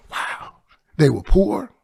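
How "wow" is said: whispered, moved wow; hushed awe. Real, not acted. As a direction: breathe it out, touched. Moved by father's memory.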